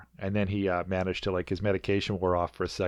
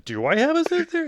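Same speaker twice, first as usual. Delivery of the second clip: very high, loud mock-bewildered squeal; squeaky incredulous question; Mr. Freeze, baffled; 5 dB louder than usual. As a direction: ask it in comic disbelief.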